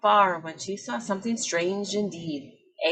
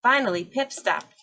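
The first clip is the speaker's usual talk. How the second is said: usual speaking voice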